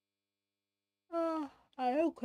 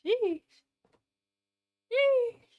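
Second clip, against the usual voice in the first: very high sheesh, second higher and louder; squeaked exclamation; 12 semitones higher, 4 dB louder than usual. Real, not acted. Streamer reacting to yapping, high squeal.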